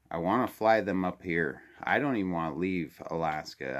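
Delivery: usual speaking voice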